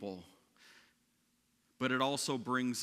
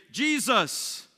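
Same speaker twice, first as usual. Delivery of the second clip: high, loud cry for help; acted alarmed shout of a name; calling out; 10 semitones higher, 8 dB louder than usual. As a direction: call out urgently, voice leaping up. Disciples cry out in the storm.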